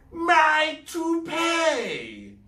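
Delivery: very high, loud, slow shriek. Acted. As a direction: shriek in dismay. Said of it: "shrieked Arturus Squidgy".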